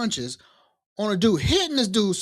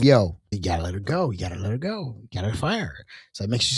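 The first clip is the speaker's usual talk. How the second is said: stern boss; 10 semitones lower, 4 dB quieter than usual. Retold or imitated. Boss demanding firing.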